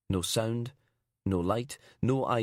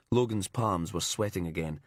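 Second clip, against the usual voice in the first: plain reading voice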